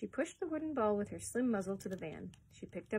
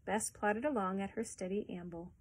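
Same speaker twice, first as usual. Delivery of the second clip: ordinary delivery, nothing marked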